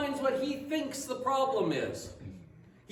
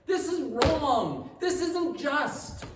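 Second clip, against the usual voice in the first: angry complaint; 4 semitones higher, 4 dB louder than usual. Retold or imitated. Older son accusing his father at the feast, loud and bitter. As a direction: let the resentment boil over. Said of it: "he says".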